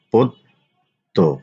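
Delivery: পদ্য slowly as pod-do, doubled d; slow model pronunciation. Shown as a demonstration. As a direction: stretch পদ্য so the double d is heard. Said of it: "আলোচনা করব শব্দের উচ্চারণ". (পদ্য ধীরে দ্বিত্ব দিয়ে উচ্চারণ)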